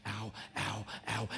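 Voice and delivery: mock whimper. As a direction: whimper it under your breath.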